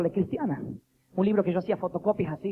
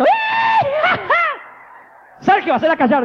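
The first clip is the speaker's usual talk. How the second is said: much higher, loud, laughing outburst; comic shout; 9 dB louder than usual. Retold or imitated. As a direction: shout down the drunk woman in the crowd. Jesus silencing a heckler, comic. ([name], riéndose, grita para que callen a la borracha)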